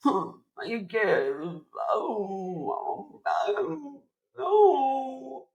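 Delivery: high, slow, hammed-up sobbing; mock whimpering. Actor moping through the whole movie, mock crying. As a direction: whimper up into squeaky pretend moans. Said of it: "the whole movie, he's like".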